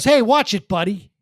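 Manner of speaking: irritated press-box guy yelling at Gary; mock angry yell. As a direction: snap it loud at a clumsy neighbor. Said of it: "uh, he goes".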